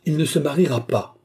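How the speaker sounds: high, forceful refusal; defiant cry. A narrator's reading. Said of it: "s'écria-t-elle". (cri de refus, voix haute)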